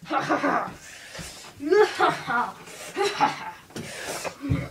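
long, high, loud cackling laugh; wild attack cackle. Pretend. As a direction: cackle wildly as you attack. Attacking the enemy in play.

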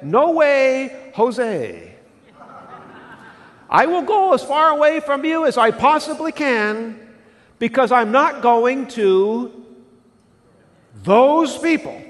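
high, loud mock refusal; defiant Jonah. Jonah refusing God's call, loud and defiant. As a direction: dig in your heels and flatly refuse. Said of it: "Jonah said".

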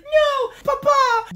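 very high, loud pleading; hammed-up wail to a father; mock desperate cry.

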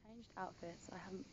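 hushed, half-whispered vlog update; whispering. Real, not acted. Vlogger updating viewers on her outfit, whispering. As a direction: keep your voice down, nearly whispering. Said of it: "I don't know why I'm whispering".

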